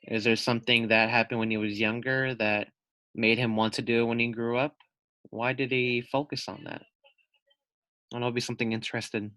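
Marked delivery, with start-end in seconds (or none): none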